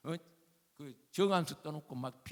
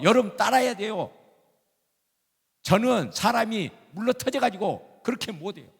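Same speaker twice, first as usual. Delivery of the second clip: loud, raised-pitch exhortation; preach; 7 semitones higher, 8 dB louder than usual. Real, not acted. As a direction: urge the congregation forcefully. Preaches at full force. (목소리 높여 권면하는 설교)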